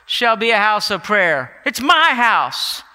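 loud, high commanding proclamation; fierce divine claim, ringing out; Jesus declaring. Retold or imitated. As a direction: thunder it out as the owner of the temple. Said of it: "and he says".

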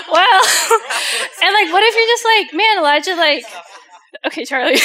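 laughing. Real, not acted. Breaks into laughter mid-story.

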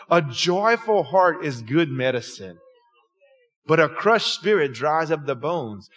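measured pulpit reading, pitched low; scripture reading. Real, not acted. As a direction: read the proverb out slowly and deliberately. Reads a Bible verse aloud.

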